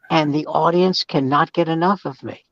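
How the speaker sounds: ordinary talk, nothing marked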